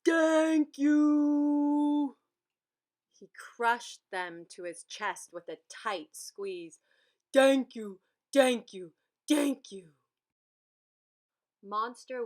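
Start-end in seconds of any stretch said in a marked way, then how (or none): 0.0-2.2 big booming shout. A narrator's reading. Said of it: "in a deep, booming voice". 7.3-9.9 loud repeated booming thanks. A narrator's reading.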